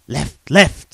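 high loud call of directions; urgent call. Acted. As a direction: call out while running.

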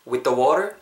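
"with the water" joined in one smooth chunk; linked pronunciation. Shown as a demonstration. Teacher repeating the joined words, linked "with the water". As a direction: run "with the" straight into "water". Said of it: "이렇게 붙을 것 같아요".

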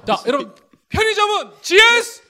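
high, laughing ad chant; call-and-response cue. Acted. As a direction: lead the crowd in the slogan. Host leads ad call-and-response.

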